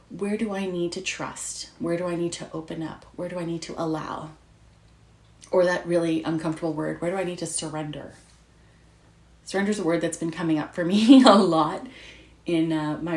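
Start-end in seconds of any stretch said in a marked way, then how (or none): none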